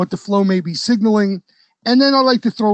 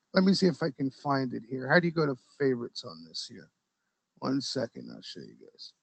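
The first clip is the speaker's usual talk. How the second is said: low, very soft mumble while searching; absent-minded, hushed search talk; muttering to oneself; 8 semitones lower, 14 dB quieter than usual. Real, not acted. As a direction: trail down into barely audible self-talk. Searching the screen for a post.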